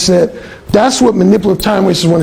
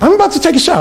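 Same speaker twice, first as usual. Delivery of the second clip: higher, pointed mock announcement; real emphatic; 8 semitones higher, much faster than usual. Alan imitating the roommate's emphatic shower announcement, pitched up. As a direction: lean on it like a hint she wants you to catch. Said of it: "She's, like, real emphatic".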